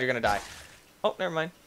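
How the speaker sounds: normal talking voice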